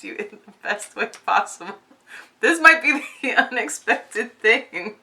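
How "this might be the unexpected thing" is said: giggling through the line, a bit higher and louder; laughing. Real, not acted. Tarot reader laughing at a surprising card to viewers. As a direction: let the laugh break into the words. Laughing while reading the cards.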